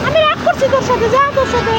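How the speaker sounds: high, childish mock-cross call to his sister; voicing the little brother. Mother speaking as her son, calling his sister to wait, childish high voice. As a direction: play the sulky small boy telling his sister to wait. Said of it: "ভাই রাগ করছে".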